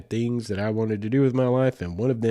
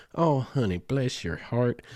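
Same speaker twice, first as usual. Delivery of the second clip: slow, drawn-out Southern drawl on honey and heart; syrupy Southern "Oh, honey, bless your heart"; 4 semitones higher, slower than usual. Retold or imitated. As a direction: stretch it out like a sweet Southern lady. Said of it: "somebody with a Southern drawl say".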